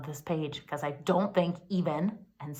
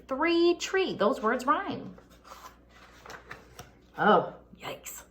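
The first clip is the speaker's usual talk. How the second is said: normal talking voice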